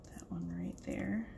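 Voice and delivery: soft unvoiced whisper to herself. Real, not acted. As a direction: whisper it while working. Crafting, muttering to herself.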